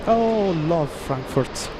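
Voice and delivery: put-on slow, loopy Scottish accent; a tired, silly Scottish accent. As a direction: drawl it out in a sleepy Scottish accent. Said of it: "why I'm saying that with a Scottish accent".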